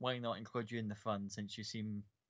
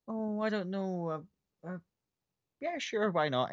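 shy hesitant Pike; 6 semitones higher, slower than usual. Acted. GM voices shy Pike accepting dance.

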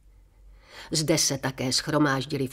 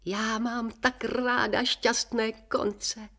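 exclaiming; 6 semitones higher, much slower than usual. A narrator's reading. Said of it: "vzkřikla paní Benešová".

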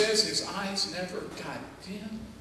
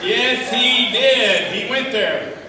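very loud preaching, big and driven; preaching shout; 3 semitones higher, 12 dB louder than usual. Acted. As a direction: shout it out to the church. Preaching at full force.

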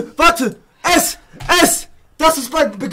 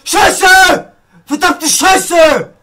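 real shouted frustration, pitched up; angry cursing; 6 semitones higher, 4 dB louder, much slower than usual. Real, not acted. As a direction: yell curses at the game. Cursing at the game. (wütend fluchend)

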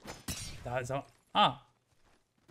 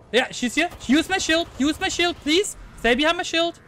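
calling out; 10 semitones higher, 7 dB louder than usual. Real, not acted. Calling teammate behind her shield.

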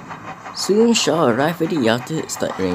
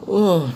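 high, sleepy ugh on waking; yawning groan; 10 semitones higher than usual. Acted. Shower grumbling at his driver, high yawning groan. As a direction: groan it out half asleep. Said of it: "yawning from his sleep".

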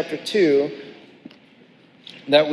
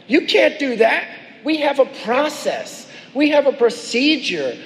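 higher, huffy voice of the religious leaders; a hammed-up bureaucratic huff; mock indignant objection; 7 semitones higher than usual. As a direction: play the stuffy officials protesting.

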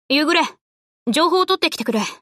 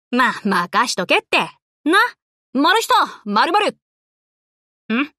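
ordinary talk, nothing marked

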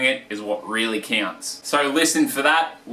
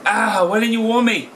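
hurt complaint; 9 semitones higher than usual. Acted.

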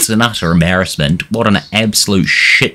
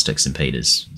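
ordinary talk

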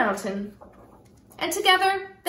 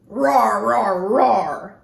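low, louder growling roar; bear roar; 5 semitones lower, 5 dB louder, much slower than usual. Acted. Bear stomping down the mountain.

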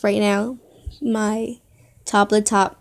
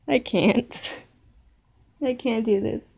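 too choked up to go on; overcome, near tears; 4 dB quieter, slower than usual. Real, not acted. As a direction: give in to real tears for a moment. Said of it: "trying so hard not to cry".

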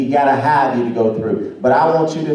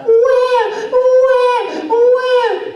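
loud, very high imitation baby wail; mimicked infant bawling, pitched way up; crying baby; 5 dB louder, much slower than usual. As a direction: bawl like a baby wanting to be held. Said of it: "the baby get down into the playpen again".

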